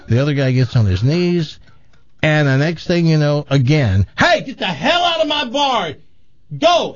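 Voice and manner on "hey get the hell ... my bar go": high-pitched acted yell; outraged bartender. Tough-bar bartender kicking out two drunks, mock outrage. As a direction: throw them out, voice jumping way up. Second bartender throws them out.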